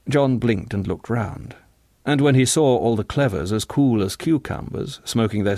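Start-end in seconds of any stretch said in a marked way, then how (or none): none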